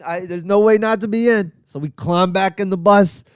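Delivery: usual speaking voice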